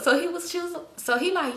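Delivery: usual speaking voice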